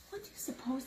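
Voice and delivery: whispered wondering question. Acted. Reader whispering a question to the listening child. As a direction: lean in and whisper it.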